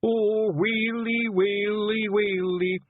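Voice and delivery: keen it like a mourner, hammed-up; a little Feegle wailing a mock lament. A narrator's reading. Woolly wails over Rob.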